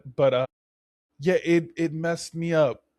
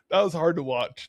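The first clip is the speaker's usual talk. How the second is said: ordinary talk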